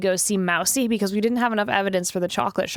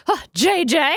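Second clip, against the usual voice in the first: pompous; 6 semitones higher, 4 dB louder than usual. Shown as a demonstration. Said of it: "That was really pompous".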